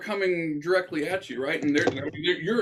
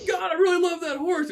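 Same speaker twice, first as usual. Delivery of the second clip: much higher, strained lament; mock anguished cry; 14 semitones higher, 3 dB louder than usual. Bystander mourning the horse in the storm, high mock wail.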